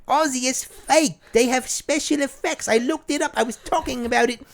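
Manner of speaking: high, slow, scolding Sharon; high slow know-it-all Sharon; Sharon impression. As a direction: lecture him in a high Sharon voice. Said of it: "she's gonna be like".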